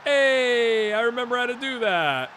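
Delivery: high, loud, stretched-out mock cheer; voicing a confident player. Gamer voices a striker who can score, gleeful high shout. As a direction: play the player suddenly remembering his skill. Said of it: "He's like".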